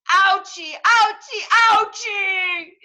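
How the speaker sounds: high, loud pained yelps; crying ouchie. Acted. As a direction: yelp each ouch. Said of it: "cried Victor Von Vulture".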